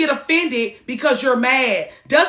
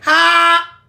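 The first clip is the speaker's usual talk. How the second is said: sharp loud ha; 7 semitones higher, 6 dB louder than usual. Retold or imitated.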